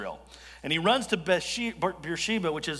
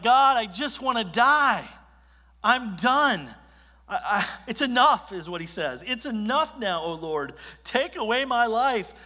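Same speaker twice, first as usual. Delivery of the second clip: self-pitying lament; 6 semitones higher, 5 dB louder than usual. Retold or imitated. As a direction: whine it like a worn-out prophet. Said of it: "Elijah's just self-pity overtakes him".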